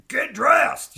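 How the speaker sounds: sharp, high, loud "get dressed"; bossy order. Retold or imitated. To a sad kid, a loud high bossy order. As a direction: bark the order like an impatient parent.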